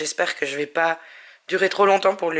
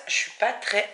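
normal talking voice

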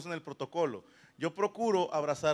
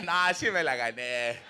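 laughing admission; 4 semitones lower, slower than usual. Retold or imitated. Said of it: "Y todavía se reía".

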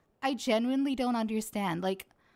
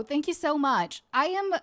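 usual speaking voice

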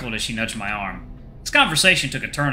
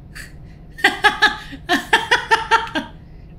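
high, bursting cackle; cackling laughter. A narrator's reading. Friend laughing at a joke, high cackle. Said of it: "Her laughter dies down to a quiet cackle".

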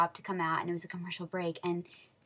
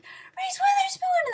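very high, loud squeal; high fangirl shriek of recognition; star-struck outburst; 7 dB louder, slower than usual. Retold or imitated. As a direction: squeal the name, drawn out slowly. Re-enacts spotting a celebrity.

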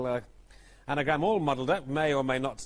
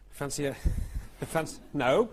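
ordinary talk, nothing marked

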